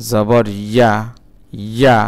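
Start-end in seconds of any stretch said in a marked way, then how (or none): none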